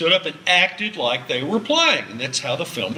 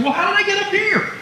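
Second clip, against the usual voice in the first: high, puzzled put-on voice; mock bewildered viewer. Lecturer mimics a viewer jolted by a cut, high mock puzzlement. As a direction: sound like someone suddenly lost on the hill. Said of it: "you're saying".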